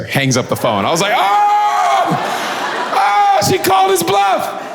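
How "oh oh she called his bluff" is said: high, gleeful surprise; a kid gasping in delight; young boy hearing the operator hang up, high and thrilled; amazed shout. Retold or imitated. Child's amazed reaction.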